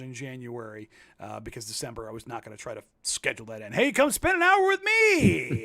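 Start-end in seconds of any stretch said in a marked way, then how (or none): 3.7-5.6 high, slow sing-song call; mock cheerful invite. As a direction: sell a silly idea brightly. Mock invitation during holidays.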